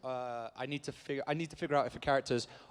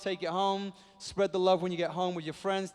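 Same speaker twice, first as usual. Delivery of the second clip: ordinary talk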